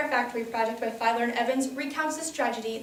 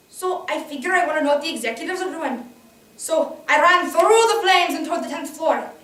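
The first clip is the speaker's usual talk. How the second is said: higher, lively character voice; determined young survivor; 5 semitones higher, 8 dB louder than usual. Acted. Survivor recalling running through flames, urgent character voice. Plays a survivor rushing upstairs.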